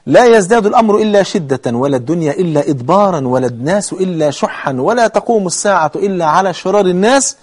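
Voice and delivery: clauses run together without pauses; repeated hadith recitation. Shown as a demonstration. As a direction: recite in one continuous flow. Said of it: "تعيده تاني".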